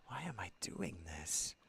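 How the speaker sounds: hushed self-questioning whisper. Acted. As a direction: ask yourself in a whisper. Steiner doubting himself.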